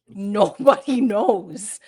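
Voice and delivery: slow, loud, laughing retort; laughing disbelief. Retold or imitated. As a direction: stretch it out through an incredulous laugh.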